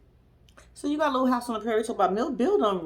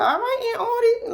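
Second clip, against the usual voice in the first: high squealed cheer; squealing all right Aunt Artie; excited cheer; 13 semitones higher than usual. Retold or imitated. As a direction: hype her up in a high squeal.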